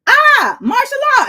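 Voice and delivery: high, loud blurting voice; high silly proclamation; mocking president. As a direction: ham up a careless leader. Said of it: "a president who was like".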